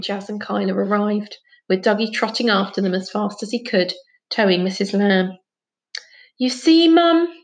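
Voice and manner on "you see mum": put-on high, loud child's complaint; cross. A narrator's reading. Dougie complaining to his mum, cross and high. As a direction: protest crossly to a parent. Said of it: "he said crossly".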